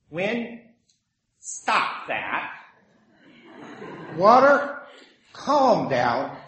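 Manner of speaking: lightly acted rebuke, pausing between words; mild scolding command. Jesus telling wind and water to stop, mildly scolding. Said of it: "He scolded the sea".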